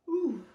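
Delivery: loud high stretching ooh; big waking-up ooh; stretching groan. Acted. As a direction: let the stretch come out as a sound. Acting out a bear stretching.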